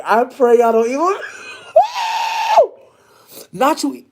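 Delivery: plead, then collapse into squealing laughter; begging through rising laughter; laughing plea. Real, not acted. Pleading with viewers while laughing.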